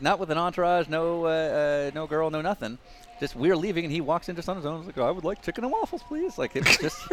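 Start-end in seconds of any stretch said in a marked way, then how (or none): 5.0-6.4 high-pitched, badly faked New Zealand accent; polite little order in a hammed-up Kiwi accent; mock Kiwi accent, rising very high at the end; Scott Dixon. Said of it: "that's how he sounded".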